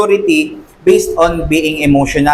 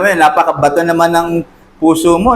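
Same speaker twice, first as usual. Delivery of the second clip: normal talking voice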